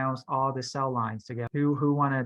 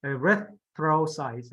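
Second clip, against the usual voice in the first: "Erythrocytes" drawn out with a clear TH; slow careful model; much slower than usual. Shown as a demonstration. Teacher confirms student's try, slowly modelling "Erythrocytes". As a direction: say "Erythrocytes" slowly, stressing RYTH. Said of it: "Say slowly".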